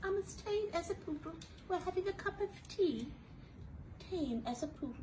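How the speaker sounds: high, dainty tea-party voice; high prissy poodle; prim poodle voice. Acted. Acting posh tame poodle.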